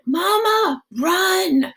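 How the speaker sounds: put-on high, desperate child voice; boy screaming for his mother to run. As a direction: scream it after her, high.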